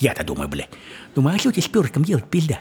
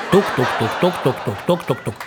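laughing tuk-tuk pecking sound; pecking noise; 3 semitones lower than usual. Retold or imitated. Teller mimics a girl pecking like a crow, laughing.

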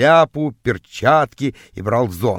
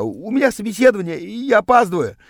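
higher, stammering, hurried plea; pleading; 7 semitones higher, faster than usual. A narrator's reading. Late boy pleads about his interview, pleading. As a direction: beg as someone running late. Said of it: "взмолился я".

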